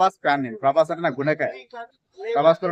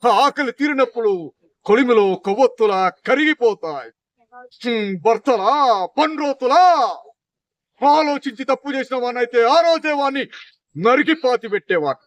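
Sai Kumar dialogue; 5 semitones higher than usual. Acted. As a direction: thunder it out like a stage villain. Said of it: "Sai Kumar గారు dialogue".

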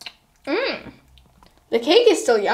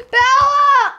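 calling out; 8 semitones higher, 5 dB louder than usual. Acted. Calling out to friend.

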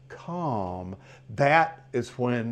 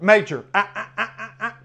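louder, higher warning ah ah; sharp correction; 6 semitones higher, 6 dB louder than usual. Shown as a demonstration. Trainer correcting his dog with a sharp warning. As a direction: snap a quick correction at the dog. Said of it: "correct within a second".

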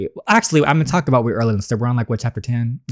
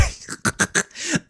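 breathy giggle; 8 dB quieter than usual. Real, not acted. Laughs after dad's joke.

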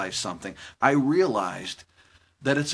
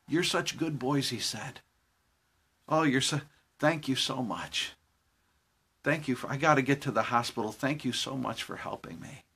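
tearful thanks; 3 semitones lower, 9 dB quieter than usual. Retold or imitated. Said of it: "He's crying".

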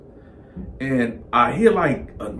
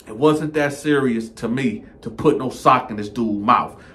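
usual speaking voice

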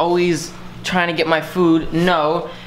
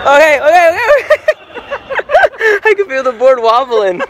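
high, loud laughing okays; giggling while wobbling; laughing excitedly; 10 semitones higher, 6 dB louder than usual. Real, not acted. Lying on wobbling board.

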